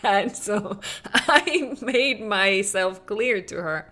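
normal talking voice

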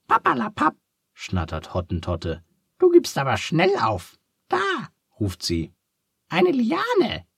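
very high, squeaky little duck, curious; a cheerful duck asking kindly. A narrator's reading. Duck dismisses, spots liana.

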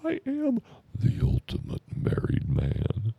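very high squeaky panic voice, far above normal; panicked Ed. Retold or imitated. Comedian voicing his terrified friend Ed in a very high squeal. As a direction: squeal it like a terrified henpecked husband. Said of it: "He's like".

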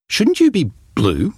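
puzzled question. Acted. Boy questions the genie.

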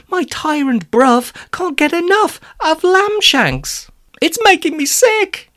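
high-pitched, distressed complaint; panicked. Acted. Arthur complains of endless lamb shanks, panicked. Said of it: "Arthur asked Romali in a panic".

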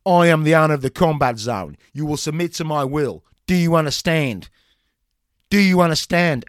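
loud barked commands; drill sergeant. Acted. Trainer barking commands.

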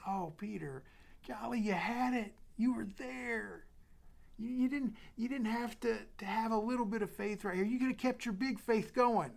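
Jesus tenderly encouraging Peter after his doubt; tender Lord. Acted. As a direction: lift the voice, gently urging Peter on.

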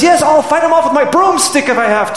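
high, loud shouting housewife; feisty housewife. Retold or imitated. Housewife on the Tube tells Churchill she'll fight, shouting. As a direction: shout it out, bold and cheeky. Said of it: "one housewife says".

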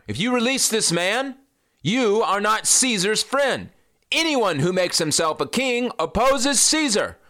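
high-pitched threat; threatening crowd. Acted. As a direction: warn Pilate as the crowd.

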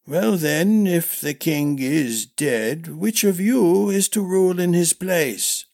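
doddering, deliberate questioning; old master-at-arms, querying. Acted. Old master asking princes who will rule, high and querying.

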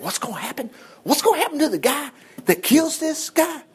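high-pitched, mock-curious questioning; eager young David. David asking the guys in camp about the reward, mock-eager. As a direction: ask it high and wide-eyed, half laughing. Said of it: "he said".

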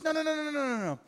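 high shouted no's; emphatic refusal. Acted. Jesus shutting down the disciples' request, high shouted no's. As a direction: cut them off with a rising string of no's.

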